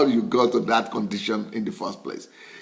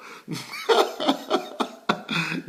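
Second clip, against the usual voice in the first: long run of amused laughter; drawn-out ha-ha-ha; hearty laugh; 7 semitones higher than usual. Real, not acted. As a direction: laugh freely at your own joke. Laughs at funeral debt joke.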